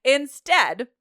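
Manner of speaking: ordinary delivery, nothing marked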